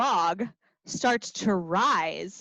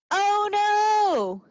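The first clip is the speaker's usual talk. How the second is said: much higher mock "oh no"; mock alarm; 10 semitones higher, 4 dB louder than usual. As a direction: gasp it out for kids. Song alarm.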